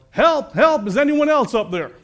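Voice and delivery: high, loud comic cry for rescue; yelling for help. Acted. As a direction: yell up for someone else's help. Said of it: "Finally, Jack yelled".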